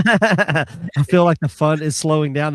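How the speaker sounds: laughing through the quote; real laughter bubbling through the words. Real, not acted. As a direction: chuckle while repeating the line. Repeating the funny quote.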